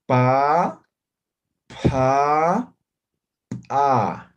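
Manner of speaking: plain pa, aspirated pa with a puff of air, then glottal a; pa plain, then blown, then popped at the throat; Aymara three-level demo. Shown as a demonstration. Teacher modelling Aymara consonant series, loud and deliberate. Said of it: "Van a ver cómo pronuncio".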